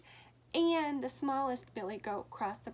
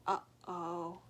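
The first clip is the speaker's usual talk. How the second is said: plain reading voice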